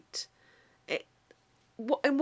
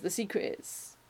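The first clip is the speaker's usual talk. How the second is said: imitating a friend; 8 dB quieter than usual. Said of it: "And he goes".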